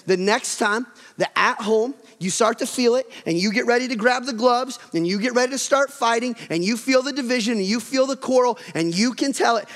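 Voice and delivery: ordinary talk, nothing marked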